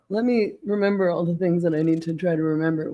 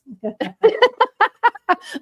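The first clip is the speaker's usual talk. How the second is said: high burst of real laughter; laughing; 5 dB louder than usual. Real, not acted. Host laughing at her own bad Spanish. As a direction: crack up at the joke.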